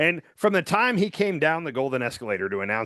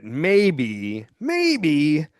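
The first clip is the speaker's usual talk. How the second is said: reluctant admission. Retold or imitated. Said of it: "admitting that".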